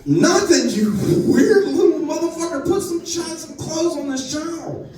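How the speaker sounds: high-pitched, exasperated scolding; exasperated uncle. Retold or imitated. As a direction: scold a kid in comic disbelief. Uncle scolding niece in retold scene.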